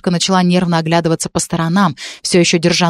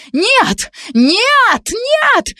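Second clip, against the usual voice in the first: each no pitched higher, shrieking; hysterical screams; much slower than usual. Acted. Girl seized by medics, screaming no higher each time. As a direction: scream as the doctors grab her. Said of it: "кричала истеричная девушка".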